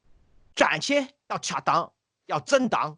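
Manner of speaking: slow imitation of a Zhejiang accent; measured, put-on Zhejiang-accented speechmaking; Jiang Jingguo. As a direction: quote a speech slowly in mimicked Zhejiang accent. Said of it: "what, what did he say?".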